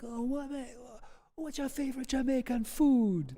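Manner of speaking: lightly voiced Jamaican interviewer; imitating Jamaican guy. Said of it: "he was like".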